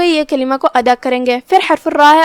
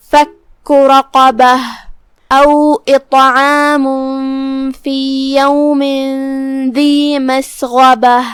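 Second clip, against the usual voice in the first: slow, melodic tajweed recitation; Quran recitation; slower than usual. Shown as a demonstration. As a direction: chant the verse slowly as a Quran teacher. Teacher recites the verses. (قرآن کی تلاوت کا انداز)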